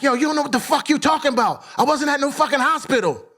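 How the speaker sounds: high, fast defensive snap; angry retort. Retold or imitated. To his wife who is scolding him, fast angry denial. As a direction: fire it back at her, fast and indignant.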